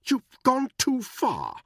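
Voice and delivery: high, slow, indignant outburst; angry Buckingham. A narrator's reading. Buckingham furious at Danny's assault, high and slow. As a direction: spit it out high and affronted. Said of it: "A flash of anger".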